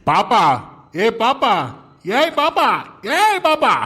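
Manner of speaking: rising call. Real, not acted. Calling louder each time.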